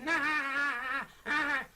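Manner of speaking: shrill dummy laugh; high puppet cackle. Acted.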